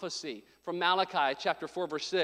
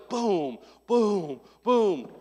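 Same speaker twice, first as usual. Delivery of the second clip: booming footstep sounds, getting louder; imitated heavy footsteps; 5 dB louder, much slower than usual. Pastor imitating a big man's footsteps on the stairs, booming. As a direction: stomp out each boom like a bear on the stairs.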